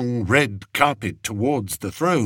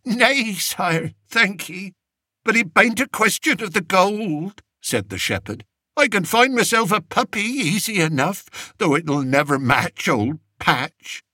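put-on higher, rustic old shepherd voice; a humble peasant begging the king; old country shepherd; 10 semitones higher than usual. Old shepherd telling the king of his journey, rustic pleading voice.

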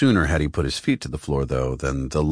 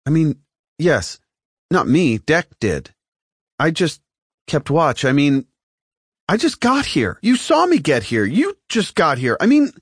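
flustered; 10 semitones higher, 4 dB louder than usual. A narrator's reading. Flustered Mattis after stammer.

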